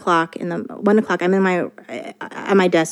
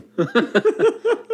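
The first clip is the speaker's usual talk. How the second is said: high laugh; 12 semitones higher than usual. Real, not acted. Recalling embarrassment.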